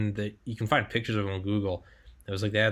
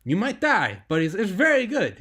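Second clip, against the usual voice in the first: much higher, louder, hammed-up Israeli accent; mock Israeli accent; 13 semitones higher, 6 dB louder than usual. Host imitating his Israeli guest on contracting pay, mock Israeli accent. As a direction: play the Israeli ex-soldier with a knowingly bad Israeli accent. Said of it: "I can't do an Israeli accent".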